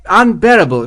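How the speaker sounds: captain nervously agreeing with the general. A narrator's reading. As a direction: blurt it out anxiously to the general. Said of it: "Morris said nervously".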